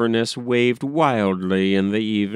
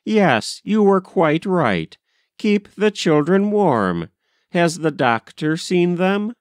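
higher-pitched, anxious mother; concerned mother; 7 semitones higher than usual. A narrator's reading. Mother giving the governess instructions about sick children, concerned. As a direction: take charge as a worried mother.

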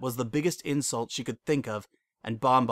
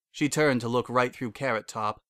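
ordinary delivery

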